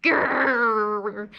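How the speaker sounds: long rumbling grrr; playful drawn-out bear growl. Pretend. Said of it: "growl like a bear".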